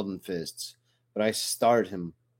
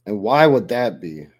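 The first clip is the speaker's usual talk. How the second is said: bristling with anger; 9 dB louder than usual. A narrator's reading. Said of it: "bristling with anger".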